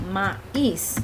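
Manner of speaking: "maíz" split as "ma... íz"; "maíz" with the two vowels pulled apart; hiatus demonstrated. Shown as a demonstration. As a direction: say "maíz" in two separate beats, ma-íz. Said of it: "voy a encontrar hiato en palabras como".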